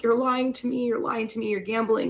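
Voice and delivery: accusing. Retold or imitated. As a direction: confront him, voice up. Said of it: "I'm like".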